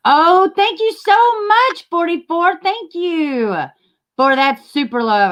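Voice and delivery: high, slow, gushing thanks; excited thanks. Real, not acted. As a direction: squeal your thanks. Thanking a viewer.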